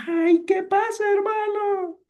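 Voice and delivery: very high, hammed-up exasperated squeal; mock outburst in a screechy high pitch; annoyed. Shown as a demonstration. Teacher playfully demonstrates snapping at a brother, annoyed. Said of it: "ahí está enfadado".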